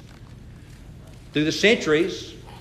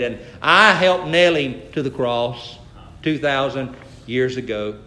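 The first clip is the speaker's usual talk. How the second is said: R G Lee; 7 semitones lower than usual. Retold or imitated. Preacher confesses to the guide at Calvary, Southern accent. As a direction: lightly imitate a Southern accent, sinking low. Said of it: "had a real Southern accent".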